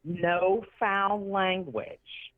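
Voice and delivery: slow, drawn-out mock admonishment; prim mock host. Charity man warning about foul language, slow mock admonishing. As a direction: stretch the warning out like an earnest organiser.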